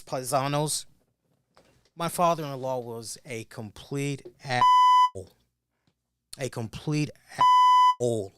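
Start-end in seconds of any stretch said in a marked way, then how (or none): 2.0-8.3 emphatic. Real, not acted. Angry rant about father-in-law.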